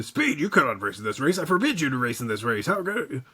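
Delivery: lightly voiced old cartoon dub; hint of a fast old dub actor; Speed Racer dub voice. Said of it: "it sounded like Speed Racer".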